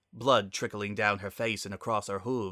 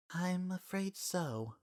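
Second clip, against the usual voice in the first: softer, slow, higher and regretful; sorrowful; 9 semitones higher, 7 dB quieter, slower than usual. Acted. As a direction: break the bad news gently. Celestia delivers sad news.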